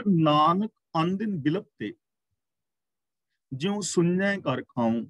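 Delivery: slow, measured recitation of a sacred verse; scripture recitation. Shown as a demonstration. Preacher quoting the Guru to the congregation, slow recitation. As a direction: intone each word of the verse slowly. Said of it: "ਅਗਲਾ ਬਚਨ ਕਰਦੇ ਨੇ".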